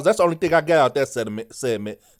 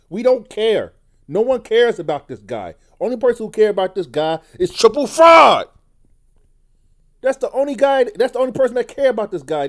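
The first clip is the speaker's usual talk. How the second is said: loud, heated, higher rant; angry rant; 6 semitones higher, 5 dB louder than usual. Real, not acted. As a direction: vent hard, fed up. Ranting against Gunther's fans.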